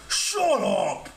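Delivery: high, strained monster shout; angry giant's outburst; growling giant. A narrator's reading. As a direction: roar to silence a pest. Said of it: "he growled".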